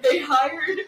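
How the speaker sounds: talking through loud laughter; laughing. Real, not acted. Laugh heard while talking.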